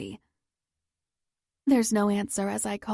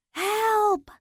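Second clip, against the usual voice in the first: desperate scream; 13 semitones higher than usual. Acted. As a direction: cry out with everything you have. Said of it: "I scream into the void".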